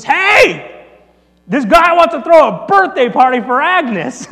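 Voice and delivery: loud, high holler across a diner; yelling to the back. Retold or imitated. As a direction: call out to someone in the back room. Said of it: "the cook yells to the back to his wife".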